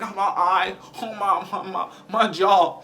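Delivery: high, stammering hurt wail; mock pain. Beaten bully crying over his injuries, mock pain. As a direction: moan haltingly through each injury, hammed up.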